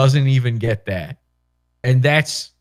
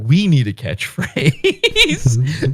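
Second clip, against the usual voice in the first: slow, drawn-out mock producer pitch; producers' voice; 2 semitones higher, much slower than usual. Producers planning the show, slow mock earnest voice. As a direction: stretch it out like a clueless TV producer. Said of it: "they were like".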